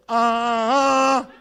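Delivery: shouted laughing "ah"; loud exclamation. Retold or imitated. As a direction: bark out a laughing "ah". Father's loud reply.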